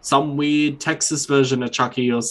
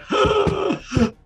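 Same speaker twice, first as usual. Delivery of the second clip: mock gasp. Mocking Facebook friends' reaction.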